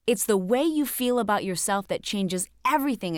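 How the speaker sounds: ordinary talk, nothing marked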